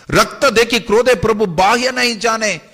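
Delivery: loud, high, dramatic verse delivery; forceful verse recitation. A narrator's reading. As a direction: declaim the verse of divine anger. Recites verse of the Lord's anger. (ज़ोरदार पद्य पाठ)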